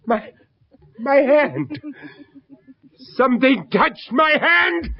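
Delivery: a terrified gasp of a line; frightened. Acted. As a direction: recoil as something touches you. Terrified in the dark room.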